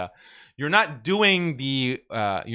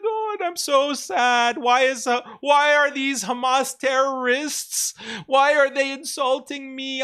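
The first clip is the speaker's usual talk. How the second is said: much higher, slow hammed-up sobbing voice; mock crying; 13 semitones higher, slower than usual. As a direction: fake weepy victim, pitched way up. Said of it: "She, she needs to cry on camera".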